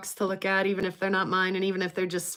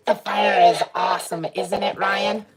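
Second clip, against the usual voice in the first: louder robot voice filter; 6 dB louder than usual. Pretend. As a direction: speak through the robot effect. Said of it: "robot voice".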